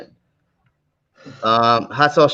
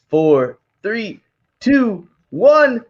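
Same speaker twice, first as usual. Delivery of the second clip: excited countdown; 7 semitones higher, 3 dB louder, much slower than usual. Real, not acted. Counting down final seconds.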